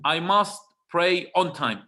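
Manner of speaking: slow, with MUST stressed, "I must pray on time"; firm self-reminder. Shown as a demonstration. Preacher telling his own soul, firm and slow. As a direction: lean hard on "must", slowly, as a vow to yourself. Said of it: "I'm talking to my soul here".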